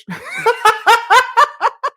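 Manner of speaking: high burst of laughter; real laughter at the chat. Real, not acted. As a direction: crack up laughing.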